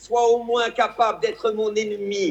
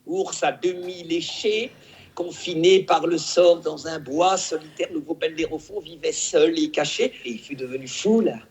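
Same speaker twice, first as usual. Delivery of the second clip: Fabrice Luchini impression. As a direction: declaim like a hammy stage actor. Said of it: "celui qui déclame de façon extraordinaire cette fable".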